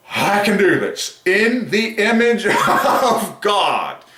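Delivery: high, slow, laughing exultation; overwhelmed proclamation. Real, not acted. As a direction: stretch it out high, breaking into laughter. Overcome, laughs while proclaiming phrase.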